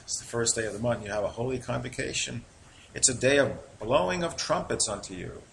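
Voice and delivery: ordinary talk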